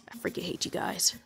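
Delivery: whispered, annoyed aside; grumpy whisper; whispered gripe. Real, not acted. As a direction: hiss it under your breath. Muttered gamer complaint.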